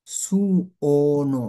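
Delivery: "suono" stretched slowly as su-o-no; drawn-out pronunciation. Shown as a demonstration. Teacher showing learners how suono sounds, drawn out. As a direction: sound out "suono" with long separated vowels. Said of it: "খেয়াল করেন, এটা হচ্ছে suono".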